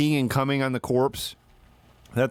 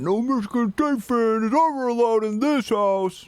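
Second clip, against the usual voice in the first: host banning rival fans, raised and high; host shouting; 10 semitones higher than usual. Retold or imitated. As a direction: bark the house rule up high. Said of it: "Shoved him out and said".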